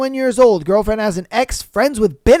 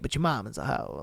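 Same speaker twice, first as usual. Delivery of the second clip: much softer and lower, mock sorry; mock apologetic; 11 semitones lower, 12 dB quieter than usual. Shown as a demonstration. Streamer softly breaking the news to the poster, mock apologetic. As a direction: drop your voice as if apologising. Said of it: "I'm sorry to say it".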